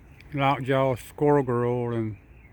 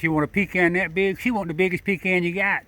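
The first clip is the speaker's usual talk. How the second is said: sing-song pet talk; 5 semitones higher than usual. Real, not acted. Baby talk to the squirrel.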